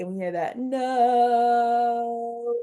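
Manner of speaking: no stretched long and slow; drawn-out open syllable. Shown as a demonstration. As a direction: hold the no so the vowel keeps going. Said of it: "two open syllables in this word".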